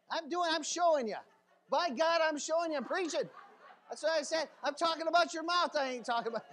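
high, loud, emphatic preacher; worked-up, insistent preaching; fired-up preaching. Acted. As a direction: shout it from the pulpit. Preaches at full force.

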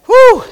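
high breathy blow-out of relief; loud whew. Real, not acted. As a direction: release the breath after heavy preaching. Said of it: "catching breath".

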